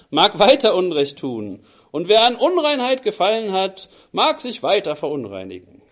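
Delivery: loud, declaiming Bible reading; scripture reading. Acted. Preacher reading Revelation aloud to the church, loud and declaiming. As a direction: proclaim the text to the congregation.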